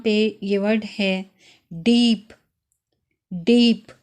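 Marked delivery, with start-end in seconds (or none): 3.3-3.9 डीप with a long stretched ई, said firmly; long ie in Dieb. Shown as a demonstration. Teacher showing the long German ie. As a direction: draw out the ई in डीप. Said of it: "ई कुछ लंबा pronounce होगा".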